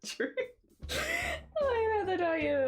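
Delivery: high, swooping posh voice; put-on posh accent. Shown as a demonstration. Streamer demonstrating a posher accent, high and hammed-up posh. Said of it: "I'm a posh accent".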